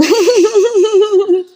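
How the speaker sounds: high giggle; amused laugh. Real, not acted. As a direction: burst into a high, rippling laugh. Amused at the child's answer. (mataas na hagikhik)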